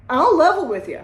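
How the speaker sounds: hammed-up earnest pitch to a using addict; emphatic pitch. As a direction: sell it like a straight-talking friend. Pitching recovery to a user.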